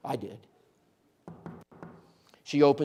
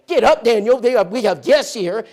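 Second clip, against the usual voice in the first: high, loud call; wife rousing husband; 8 semitones higher, 12 dB louder than usual. Retold or imitated. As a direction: shake him awake with your voice. Wife waking sleeping husband.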